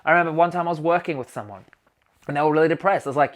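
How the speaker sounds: ordinary talk, nothing marked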